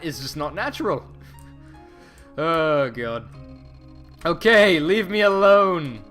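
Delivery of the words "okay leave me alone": loud exasperated. Acted. Voicing character, brushing someone off.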